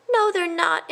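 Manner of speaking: high, quick cut-in; interrupting mouse. A narrator's reading. A mouse interrupts his cousin, high. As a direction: cut in urgently. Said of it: "interrupted Christopher".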